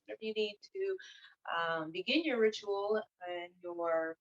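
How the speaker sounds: soft, unhurried meditation guide; guided meditation. Acted. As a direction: ease them gently into stillness, leaving long pauses. Leads listeners into meditation.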